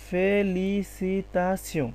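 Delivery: very slow, each syllable of ফেলিসিতাসিও separated. Shown as a demonstration. Teacher assembling the French word for learners, very slow syllable by syllable. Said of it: "তার মানে এটা কি হবে?".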